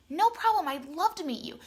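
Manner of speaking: high, bright-pitched quoted welcome; eager reply. Retold or imitated. New contact eagerly accepts her lunch invitation, imitated eager reply. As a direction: imitate a delighted yes, pitch jumping up.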